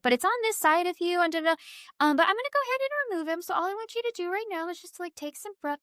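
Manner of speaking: breezy high medium fading to a hush; psychic's calm instructions. Retold or imitated. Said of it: "she said, like".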